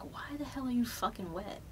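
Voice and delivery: confused whisper to herself; startled whisper under her breath; whispered inner thought. Retold or imitated. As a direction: hush it like a private panic.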